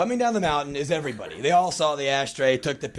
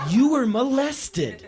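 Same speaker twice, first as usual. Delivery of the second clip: high, slow, drawn-out concern; old lady realizing; 10 semitones higher, 5 dB louder, slower than usual. Retold or imitated. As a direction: spell it out slowly to a child. Lady repeats word to boy.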